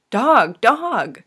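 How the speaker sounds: high, swooping repeated yelps; excited exclamation. A narrator's reading. Friend spotting retrievers through the window, excited. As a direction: point it out with delighted urgency. Friends spot five dogs outside.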